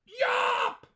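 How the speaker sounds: put-on a very high, squeaky little-boy yell; shouted yawp. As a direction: yelp it out like a tiny boy. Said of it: "he shouted out".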